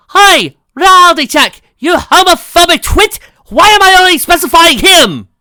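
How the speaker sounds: high, loud outraged yelling; furious shout. Acted. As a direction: scream it in outrage. Reality check voice yells at narrator.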